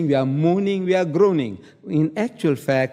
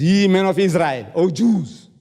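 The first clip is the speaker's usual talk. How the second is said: raised, declaimed address; proclaiming; 2 semitones higher, 3 dB louder than usual. Acted. Peter addressing the men of Israel, proclaiming. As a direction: call out to a crowd like an apostle.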